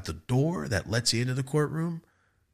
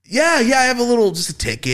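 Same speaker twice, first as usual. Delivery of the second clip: nervous eager reply; 13 semitones higher, 6 dB louder than usual. Retold or imitated.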